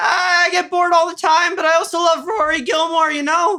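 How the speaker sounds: very high strained cartoon voice; Rick and Morty impression. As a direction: squeal it out high like a cartoon sidekick. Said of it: "trying to do a Rick and Morty impression".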